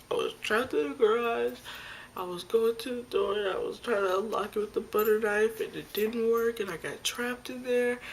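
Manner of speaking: high, loud, breathless-sounding outpouring; frantic explaining. Retold or imitated. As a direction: blurt the whole excuse out to mom.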